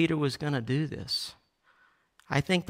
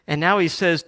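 ordinary talk

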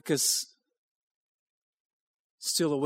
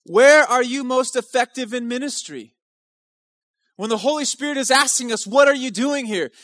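higher, louder preaching, pressing the question; raised preaching voice; 8 semitones higher, 7 dB louder than usual. Acted. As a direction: lean in and challenge the room. Challenging congregation, raised voice.

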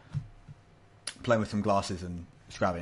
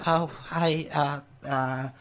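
put-on higher-pitched, hesitant old lady greeting; shaking old lady; 6 semitones higher, much slower than usual. Old lady greeting a stranger who sat close, shaking nervously. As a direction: stammer a nervous hello. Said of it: "she's shaking a bit".

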